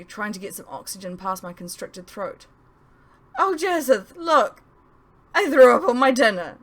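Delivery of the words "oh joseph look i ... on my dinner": giddy, high and loud; excited high-pitched calling out. Acted. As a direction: call out in dizzy relief, higher. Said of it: "sounding a bit giddy".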